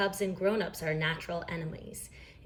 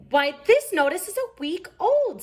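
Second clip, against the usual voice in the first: high, louder indignation; indignant Mama; 12 semitones higher, 6 dB louder than usual. A narrator's reading. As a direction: scold over the old notice. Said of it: "said Mama".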